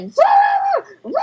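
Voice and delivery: high-pitched mock German Shepherd bark; shrill-pitched "rah" barks; barking dog. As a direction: bark wildly like a dog making a fool of itself. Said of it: "just barking".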